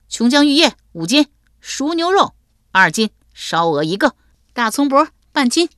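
high, loud, imperious order of food; a high, bossy lady reeling off her menu; demanding mistress voice. Acted. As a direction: rattle off the order like a spoiled lady.

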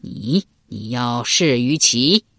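halting, drawn-out surprise; astonished and delighted. A narrator's reading. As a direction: draw out the question in pleased disbelief. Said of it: "齐桓公又惊又喜".

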